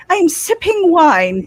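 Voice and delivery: a hint of posh wine sipper; prim with pinkies up. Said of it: "Pinkies up".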